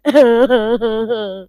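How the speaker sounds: a short laugh after the joke. Acted. Husam laughing at his own joke about hunger, short laugh. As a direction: chuckle at your own joke.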